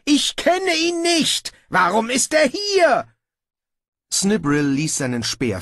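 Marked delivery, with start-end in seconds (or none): 0.0-3.1 very high outraged wail; petulant ruler howling in hot anger. A narrator's reading. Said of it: "heulte er". (empört heulend)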